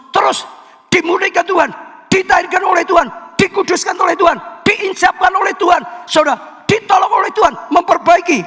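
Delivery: high, shouted, fast run of proclamations; fiery preaching. Acted. Preacher proclaiming God's cleansing to the congregation, shouted. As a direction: hammer each phrase like a revival call. Preacher exhorting at full force.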